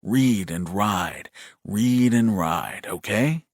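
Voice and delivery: slow, lilting cadences with stretched words; sing-song soothing. A narrator's reading. Brother calming his shaking brother, soothing sing-song. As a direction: lull him with a steady, rhythmic chant. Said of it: "Resorting to the sing-song cadences".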